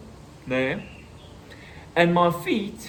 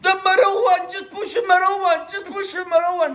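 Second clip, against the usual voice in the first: high, louder frantic instructions; imitating mom's frantic shout; 4 dB louder than usual. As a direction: shout directions to a panicking driver. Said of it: "And she said".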